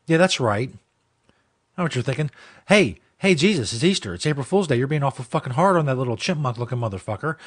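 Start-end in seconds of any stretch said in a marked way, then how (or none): none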